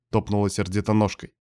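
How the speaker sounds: plain reading voice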